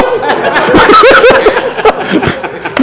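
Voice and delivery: real high-pitched laugh. Real, not acted. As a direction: crack up at your own story. Laughing at own retold story.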